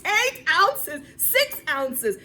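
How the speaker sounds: loud, high stress; emphatic. Acted. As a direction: punch it out eagerly. Repeats bottle size.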